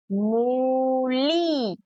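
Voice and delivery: "मूली" with both vowels heavily stretched; long ऊ and ई. Shown as a demonstration. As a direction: draw out "मूली" slowly as moooo-leee. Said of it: "थोड़ा खींचकर".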